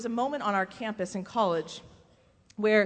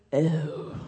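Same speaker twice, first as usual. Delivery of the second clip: low, deflated "oh"; imitated letdown, low-pitched; disappointed; 7 semitones lower than usual. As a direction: mimic someone hearing bad news, dropping low. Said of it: "I got the disappointed".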